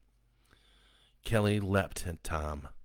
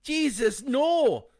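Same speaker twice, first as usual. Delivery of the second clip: high, loud horrified yell; husband screaming as his wife attacks their son; 9 dB louder, much slower than usual. A narrator's reading. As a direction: scream it in horror. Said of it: "Tom yelled".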